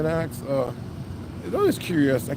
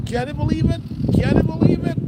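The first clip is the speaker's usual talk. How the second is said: excited; 9 semitones higher than usual. Real, not acted. Excited at the landmark.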